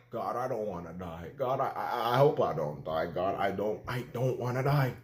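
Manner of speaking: low, hushed plea to God; quiet, low-voiced begging; retold pleading prayer. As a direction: pray under your breath, lightly acted. Retold prayer while lying there.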